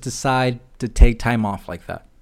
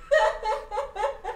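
high cackle; 6 dB quieter than usual. Retold or imitated. Laugh after mock redneck cheer.

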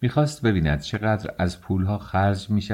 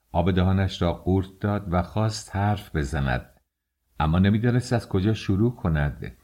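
ordinary delivery

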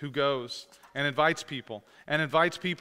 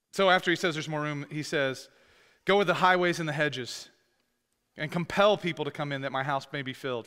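louder, rising proclamation; forceful scripture reading; 5 dB louder than usual. Acted. Pastor reading Jesus's command to the church, proclaiming. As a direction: read it out like a herald.

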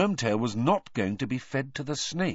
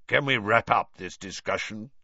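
growl. A narrator's reading. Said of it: "growled Moody".

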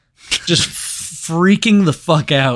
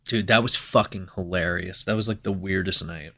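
much softer, lower and calmer; quiet; 6 semitones lower, 6 dB quieter than usual. Real, not acted. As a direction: let it drop, worn out from laughing. Winding down after the story.

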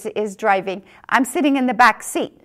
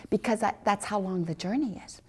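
whispered aside; 2 semitones lower, 8 dB quieter than usual. Real, not acted.

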